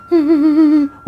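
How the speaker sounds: put-on a shrill, high wail from the possessed girl; high wailing cry. The possessed girl wailing while being scolded, high eerie wail. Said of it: "Di cewek ini nangis".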